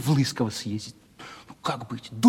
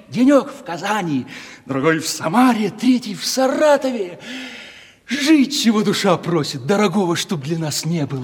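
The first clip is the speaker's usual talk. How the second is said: всё выше и громче, в упоении; разгуляйся голосом, мечтая; размечтался; 6 semitones higher than usual. Acted. Размечтался о гулянье. (восторженно мечтает о разгульной жизни с ней)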